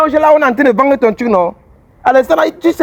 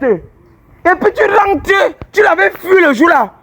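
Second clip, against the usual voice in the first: higher, slow angry shout; 4 semitones higher, much slower than usual. Acted. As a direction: yell it out in anger. Angry healer scolds the girl.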